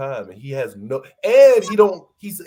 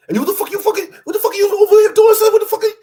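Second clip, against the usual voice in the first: high, loud mock tirade; exaggerated squawking outburst, imitating a friend; hostile New Yorker impression; 9 dB louder, faster than usual. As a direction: rant like an aggressive New York friend, pitched way up. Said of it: "he's always like that when he".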